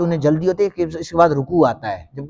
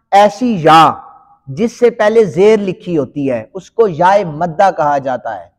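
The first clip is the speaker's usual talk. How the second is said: ordinary delivery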